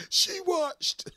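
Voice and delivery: high, loud cracked yell; mock shout. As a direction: crow the punchline. Punchline yelled in Bernie voice.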